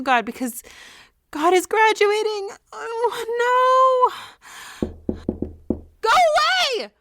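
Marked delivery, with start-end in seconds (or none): 1.3-4.9 high, loud crying wail; loud anguished cry; wailing dismay. Acted. Crush is graduating, she despairs. 6.0-6.9 high, loud yell at a sibling; screeching angry teen; furious shout. Acted. Fighting with sibling over room.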